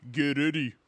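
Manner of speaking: loud arcade fight announcer. Retold or imitated. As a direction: boom it like a fighting game announcer. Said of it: "the Darkstalker's announcer when he goes".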